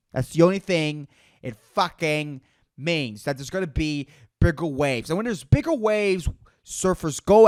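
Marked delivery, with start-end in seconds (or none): 0.1-3.2 slow, word by word; deliberate, spaced-out insistence; slow emphatic repeat. Real, not acted. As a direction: spell it out with gaps between phrases. Slow emphatic repeat of previous line.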